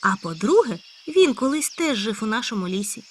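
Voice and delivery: high loud eager hare; a hare bursting with news. Acted. Hare character voice. (високий гучний схвильований заєць)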